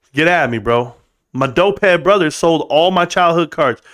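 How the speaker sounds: normal talking voice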